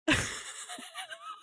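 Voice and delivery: high squealing laugh; real helpless burst of laughter; laughing hard. Real, not acted. Laughing at the song guess.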